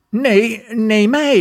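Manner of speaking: self-control calls out to be chosen first; called out. Acted. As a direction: cut in eagerly, calling out. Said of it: "riep zelfbeheersing".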